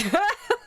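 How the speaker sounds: high burst of laughter; amused laugh. Real, not acted. Storyteller cracking up at the confession story, amused laugh. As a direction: laugh out high at your own retelling. Laughs after acted shocked reply.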